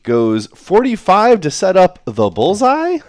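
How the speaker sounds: slow, loud and high in disbelief; real astonishment; incredulous. Real, not acted. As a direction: spell the shot out in amazement. Commentator exclaims over a baffling shot.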